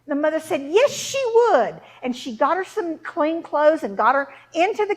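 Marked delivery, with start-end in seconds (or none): 0.7-1.8 high, loud, slow emphatic yes; eager acceptance. Acted. Mother accepting for daughter, loud eager insistence. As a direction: jump in with a pitched-up, stretched answer.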